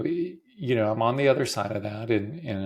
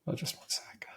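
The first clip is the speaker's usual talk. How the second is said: whispered; 12 dB quieter than usual. Real, not acted. Breaks off while crying.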